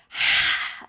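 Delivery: big loud sigh through the mouth; forceful sigh. Shown as a demonstration. Teacher exhaling with the class, loud forceful sigh. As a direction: push the breath out hard. Breath practice, emphasised exhalation.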